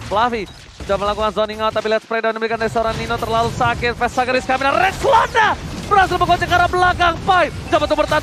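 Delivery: ordinary delivery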